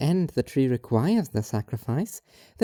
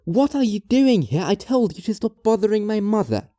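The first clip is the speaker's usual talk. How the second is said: louder, sharp challenge at the intruder; angry confrontation; 4 semitones higher, 4 dB louder than usual. Acted. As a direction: confront the stranger by her mother's bed. Daughter bursts in on stranger.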